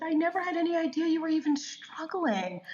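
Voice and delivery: high-pitched mock-tearful voice; imitated tearful friend. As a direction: imitate her astonished, weepy reply, pitched high. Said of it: "burst into tears".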